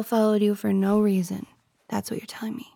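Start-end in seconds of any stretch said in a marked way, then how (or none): 1.9-2.7 hushed, sulky whisper. Acted. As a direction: breathe it out in a whisper. Jealous girlfriend muttering accusation.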